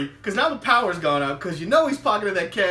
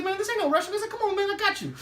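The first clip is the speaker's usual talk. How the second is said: high reassuring; 12 semitones higher, 3 dB quieter, faster than usual. Retold or imitated.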